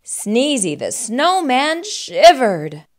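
ordinary delivery

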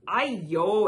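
loud papa's exclamation; exclaiming. A narrator's reading. Papa to his hungry sons, exclaiming. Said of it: "exclaimed Papa".

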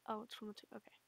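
ordinary talk, nothing marked

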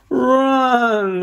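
panicked cry to run; yelled. Acted. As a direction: shout the warning to flee. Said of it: "Lucas yelled".

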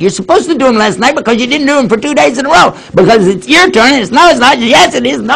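high, fast squabbling; mock bickering sisters. Daughters quarrelling in the kitchen, high fast bickering. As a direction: sisters going back and forth. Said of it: "daughters raising their voices".

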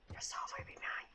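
soft creepy whisper; demon whisper. Acted. Babysitter voicing the demon baby, creepy whisper. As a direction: breathe it like a lurking demon.

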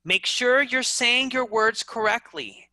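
ordinary delivery

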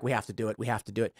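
hushed imitation of executives insisting; mock resigned mutter. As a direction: mumble it like a stubborn exec giving in. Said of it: "And they're like".

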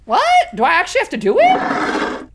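high, loud, incredulous question; startled disbelief. Real, not acted. Player learning the homework is real, loudly incredulous. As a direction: blurt it out, taken aback.

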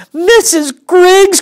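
high, loud, slow wail of a name; crying out. Acted. Susan crying out to the teacher, high and loud. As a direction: call the teacher in outrage. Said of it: "cried Susan".

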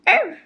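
high sharp yappy arf; imitated puppy bark. Puppy barking at the neighbor petting Blue, high yap. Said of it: "opens up her little mouth and goes".

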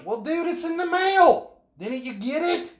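high, far-off put-on voice, playing dumb; mock innocent excuse. To the bill collector on the phone, a high mock-innocent excuse. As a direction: brush off the caller with fake innocence.

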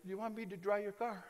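soft, lightly voiced little person; little old car dryer. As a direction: offer it timidly, under the voice. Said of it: "They just look at you like".

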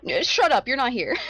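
mock-yell at a friend, laughing underneath; playful shout. Real, not acted. Teasing a player who shouldn't hear this, with a loud playful shout. Player jokingly scolds absent player.

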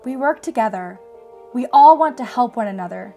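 usual speaking voice

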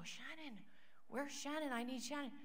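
high, urgent calling for Shannon; elder calling for Shannon mid-prayer. Retold or imitated. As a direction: call out across the room, urgent. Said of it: "I see him go".

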